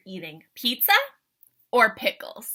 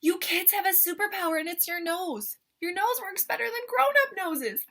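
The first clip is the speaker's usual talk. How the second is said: high, laughing thrilled praise; excited praise; 7 semitones higher than usual. Real, not acted.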